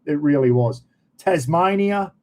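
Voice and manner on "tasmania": lightly put-on, higher formal "Tasmania"; mock formal name. Shown as a demonstration. Correcting himself from the formal name to the local one. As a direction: say "Tasmania" as the stiff proper name, then drop it. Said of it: "oh, no, mate".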